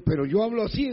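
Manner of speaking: normal talking voice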